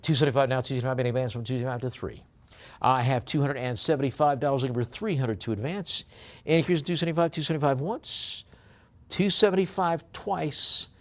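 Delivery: quick, lifted bid-calling, drawing out the going calls; auctioneer's call. Acted.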